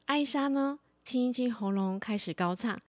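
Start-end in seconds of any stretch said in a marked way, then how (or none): none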